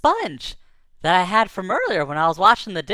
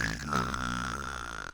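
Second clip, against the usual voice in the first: soft snore; 12 dB quieter than usual. Acted. Chameleon snoring.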